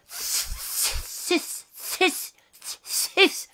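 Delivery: stuttering unvoiced sis, sis, sis; stuck on a word. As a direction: hiss out the first syllable, unable to get the word out. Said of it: "she says".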